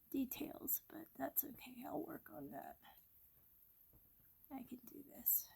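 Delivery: soft unvoiced whisper to herself; breathy whisper, half to yourself; whispering while drawing. Real, not acted. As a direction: murmur it as you concentrate on the sketch.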